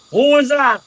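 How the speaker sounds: a hint of a suspicious guard; dwarf guard calling out. Dwarf guard hearing noise below, calling out. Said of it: "you hear him go".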